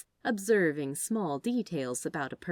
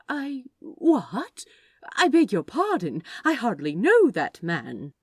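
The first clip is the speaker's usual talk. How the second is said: loud, higher startled protest; flustered shock; 5 semitones higher, 8 dB louder than usual. Acted. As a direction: sputter in disbelief. Shocked by marriage prediction.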